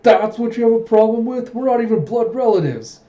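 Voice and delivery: loud, high-pitched acted outrage; hammed-up quarrel voice; indignant retort. Acted quarrel between Huck and Mundok.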